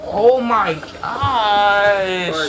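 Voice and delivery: long, drawn-out, loud groan of disbelief; exasperated oh my gosh. Real, not acted. Reacting to the spooky clip, a drawn-out exasperated groan. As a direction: stretch it out, fed up.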